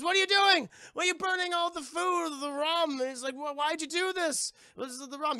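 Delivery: high, loud outraged yelling. Retold or imitated. Pirate yells at Elizabeth over the burned rum, outraged. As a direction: bellow in disbelief at the burning rum.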